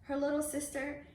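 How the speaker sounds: plain reading voice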